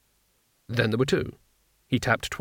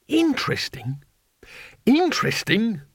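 disbelieving echo, rising in pitch; incredulous; 8 semitones higher than usual. A narrator's reading. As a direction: repeat it in disbelief. Incredulous repeat.

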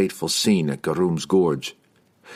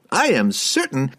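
reassuring wizard; 7 semitones higher than usual. Acted. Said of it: "Harkle assured him".

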